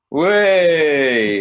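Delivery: high, loud party whoop; drunken whoop. Retold or imitated. Retelling his drunk self whooping on holiday. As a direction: whoop it like a drunk woo girl. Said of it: "all I keep doing is going".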